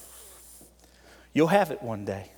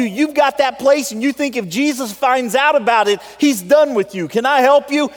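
loud, high, driving sermon voice; fired-up pulpit preaching; full-force preaching; 12 semitones higher, 10 dB louder than usual. Acted. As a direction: preach it hard to the room.

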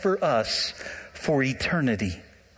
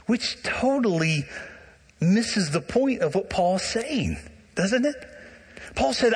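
ordinary talk, nothing marked

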